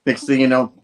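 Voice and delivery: ordinary talk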